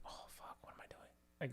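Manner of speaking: hushed, breathy whisper to himself; panicked whisper. Retold or imitated. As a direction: whisper it like you're scared he'll hear. Retold panicked inner thought.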